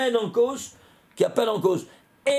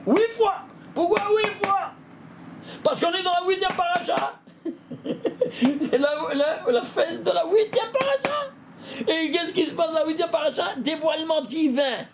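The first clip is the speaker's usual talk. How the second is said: much higher, near shouting with laughs; excited; 7 semitones higher than usual. Real, not acted. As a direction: burst out with delight at the discovery. Eureka moment in lesson.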